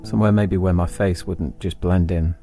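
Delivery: plain reading voice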